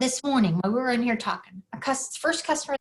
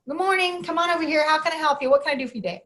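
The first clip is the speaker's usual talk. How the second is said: higher, brisk shop-clerk patter; chirpy counter greeting; 7 semitones higher, 3 dB louder, faster than usual. Retold or imitated. Butcher greeting the first customer, chirpy routine.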